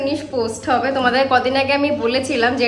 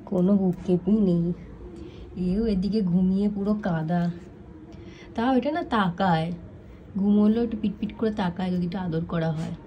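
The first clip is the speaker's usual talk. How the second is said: hushed and much softer near sleeping dogs; speaking softly; 6 semitones lower, 8 dB quieter than usual. Real, not acted. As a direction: keep your voice down so they stay asleep. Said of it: "আমি আস্তে আস্তে কথা বলছি".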